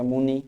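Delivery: मुनि with a short, quick final इ; short final vowel. Shown as a demonstration. Teacher showing a short vowel, मुनि said briefly with an unstretched इ. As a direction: say मुनि briefly, without stretching the इ. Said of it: "ह्रस्व हमेशा कम समय में बोला जाएगा".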